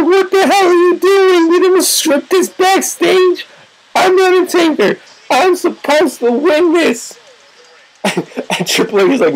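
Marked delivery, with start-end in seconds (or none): 0.0-7.2 high, indignant protest; outraged Undertaker. Acted. Acting Undertaker pissed at kick-out.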